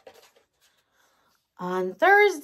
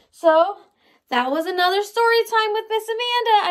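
cheery sign-off; 9 semitones higher, 4 dB louder, faster than usual. Real, not acted.

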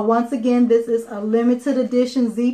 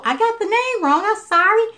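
flustered self-correction; 10 semitones higher than usual. Real, not acted. Catches her own naming mistake.

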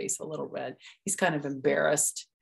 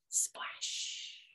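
whispered splash; 13 dB quieter than usual. A narrator's reading. Sound effect.

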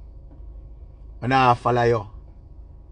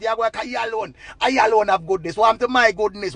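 ordinary delivery

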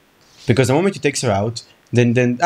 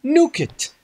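high mock command; 9 semitones higher than usual. Joking plan to nuke town.

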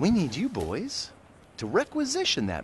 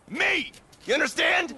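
angry shout; 13 semitones higher than usual. Acted. Agent yells at the crew that he's in charge. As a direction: yell that you're in charge. Boss yelling his authority.

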